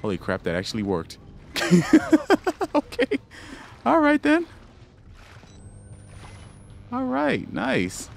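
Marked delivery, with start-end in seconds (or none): 1.2-7.5 high, giddy laugh and words; relieved laughter. Real, not acted. Relief after the trick worked.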